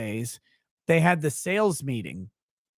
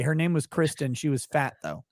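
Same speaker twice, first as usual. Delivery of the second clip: usual speaking voice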